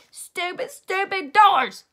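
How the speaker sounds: loud, high, slow angry holler; cursing the locked doors. A narrator's reading. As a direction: stretch out the frustration. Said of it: "I hollered".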